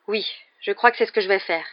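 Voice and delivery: ordinary delivery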